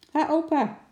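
put-on high, loud chirpy little boy; cheerful call. Little boy greeting his grandpa at work, cheerful high call. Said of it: "riep Paaltje opgewekt".

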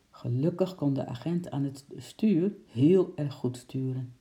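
usual speaking voice

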